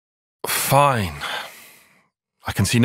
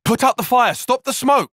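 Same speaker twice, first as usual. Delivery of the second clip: urgent shout; 13 semitones higher than usual. Acted. Commander orders the crew to kill the smoke, shouting. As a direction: yell it over the chaos.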